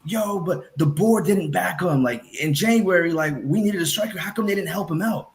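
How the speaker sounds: high, slow, whiny mock fan voice. Podcaster mocking fans who blame the board, whiny high voice. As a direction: mimic a complaining fan, drawn out and high. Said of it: "folks like Igal talking about".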